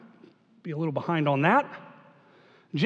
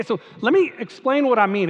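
ordinary talk

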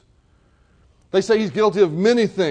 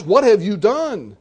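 louder, slower, exasperated demand; 2 semitones higher, 4 dB louder, slower than usual. Retold or imitated. Pilate demands an answer from silent Jesus, exasperated. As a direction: lean on "have", fed up. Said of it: "Pilate is very exasperated".

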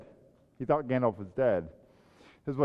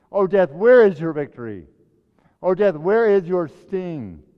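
taunting preaching; 6 semitones higher, 8 dB louder than usual. Acted. Pastor voicing Paul taunting death, loud defiant preaching. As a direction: hurl the words at death itself.

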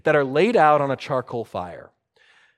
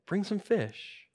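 soft, slow, raised pitch; understated quoted request; 4 semitones higher, 9 dB quieter than usual. Retold or imitated. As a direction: quote it gently, without pressing. Jesus quoted, first take.

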